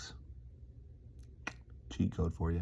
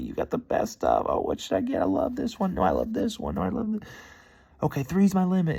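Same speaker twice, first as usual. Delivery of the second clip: put-on giddy kid-in-a-candy-store reenactment; breathless mock delight over candy; freaking out; 7 semitones higher, 3 dB louder than usual. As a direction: gush with the pitch leaping up and down. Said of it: "I'm freaking out".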